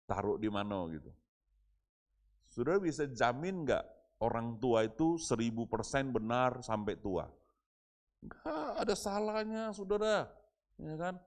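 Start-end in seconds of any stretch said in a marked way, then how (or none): 2.5-11.1 higher, louder pulpit appeal; fervent preaching. Acted. Pastor urging the congregation at full force, fervent preaching. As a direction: press the point home to the congregation.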